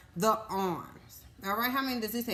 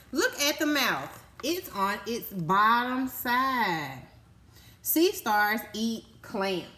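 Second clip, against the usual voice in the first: slow, louder storybook reading; reading to children; 2 semitones higher, 4 dB louder, slower than usual. Acted. Teacher reading sea star facts to kids, slow and emphatic. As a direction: stretch each word out for the class. Reading book page aloud.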